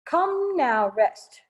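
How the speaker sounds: normal talking voice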